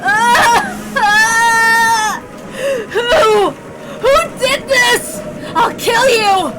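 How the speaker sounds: loud, high, sobbing screams of rage; grieving son howling for revenge; enraged crying shout. Acted. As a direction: scream at the unseen killer through tears. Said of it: "George and Battler sound like they're not actually crying".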